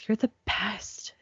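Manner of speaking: soft unvoiced whisper into the ear; whispered encouragement. Labor nurse to exhausted mother, a whisper in her ear. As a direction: breathe it like a secret, no voice. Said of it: "leaning over me and whispering sweet nothings".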